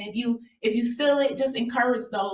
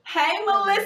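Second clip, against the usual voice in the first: high, bright call of welcome to the guest; host greets guest Melissa, excited and much higher; excited greeting; 10 semitones higher, 4 dB louder than usual. Real, not acted. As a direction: sing out her name as she joins.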